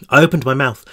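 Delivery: ordinary delivery, nothing marked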